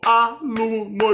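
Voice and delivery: "aluno" slowly split as a-lu-no; syllable by syllable. Shown as a demonstration. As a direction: sound out "aluno" in three separate syllables, a, lu, no. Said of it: "igual a professora fazia".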